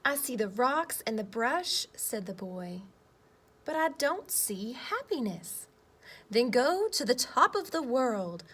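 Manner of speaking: lively picture-book read-aloud with voiced boy and earth; reading to children. Teacher reading a story to her class, lively read-aloud.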